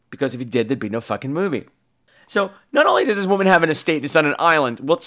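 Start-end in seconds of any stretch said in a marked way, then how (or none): none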